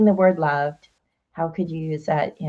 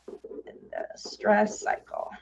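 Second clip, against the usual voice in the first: slow, soft whisper while noting it down; whispered to self; 4 semitones higher, 8 dB quieter, much slower than usual. Real, not acted. Host repeating the phrase to herself as she writes, whispering. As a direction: mutter it as you write it down. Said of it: "I'm gonna write that down".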